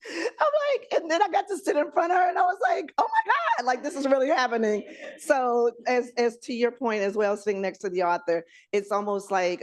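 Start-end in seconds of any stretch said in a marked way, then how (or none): 0.1-4.8 squealing high and breathless; fangirling. Retold or imitated. Seated in front of the author, squealing fan. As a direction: shriek with star-struck joy.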